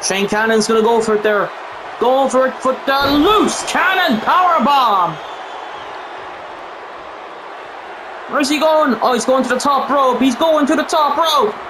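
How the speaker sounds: hyped play-by-play of the match; wrestling commentator. Real, not acted. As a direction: call the action live.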